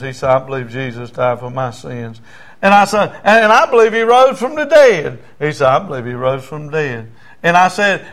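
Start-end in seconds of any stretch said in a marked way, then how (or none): 0.3-2.2 understated imitation of a crying man; tearful repetition. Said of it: "He's crying". 3.2-5.2 high, loud proclamation of faith; forceful raised prayer line; leading a prayer. Retold or imitated. Preacher leads prayer for repeating. 5.7-7.1 understated imitation of a crying man; subdued repeat of the prayer; tearful repetition. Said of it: "He's crying".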